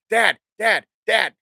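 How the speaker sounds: put-on a kid tugging at dad's sleeve; imitating daughter calling. Dad mimicking daughter calling him repeatedly, insistent. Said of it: "proceed to tell me".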